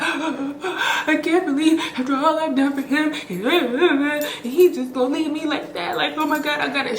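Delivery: high, whiny imitation of a sobbing friend; mock crying friend. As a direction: mimic the crying friend mid-breakup. Friend crying over breakup, mimicked.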